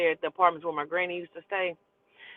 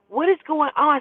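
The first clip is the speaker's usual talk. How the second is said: calling through door; 8 semitones higher, 5 dB louder than usual. Retold or imitated. Cousin's girlfriend calling through door.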